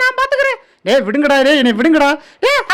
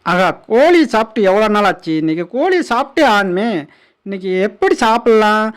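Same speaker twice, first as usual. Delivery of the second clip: deep animal voice. Acted. Voiced predator eyeing chicken.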